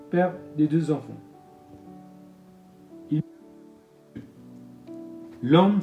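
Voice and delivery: normal talking voice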